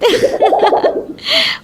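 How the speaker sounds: high breathy gloating laugh; triumphant cackle. Acted. Nanda gloating over her sister-in-law, high breathy laugh. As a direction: laugh at outwitting her rival.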